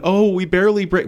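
high-pitched mock poor-me plea; put-on hard-luck owner; mock pleading. Mocks owner pleading poverty.

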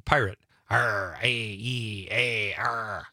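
slow drawn-out pirate roar; pirate growl. Shown as a demonstration. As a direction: stretch it out like a pirate. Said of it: "spoken like a pilot. Pirate".

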